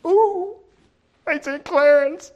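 high, loud amazed angel chorus; awed angels. Pretend. Onlooking angels marvel at Clarence, high and loud. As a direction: gasp like astonished angels. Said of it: "the rest of the angels are going".

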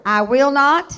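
loud, ringing declaration for the church to echo; emphatic call to repeat. Shown as a demonstration. Preacher leading the church in a loud declaration. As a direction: lead the congregation, each word hammered. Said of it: "say this with me".

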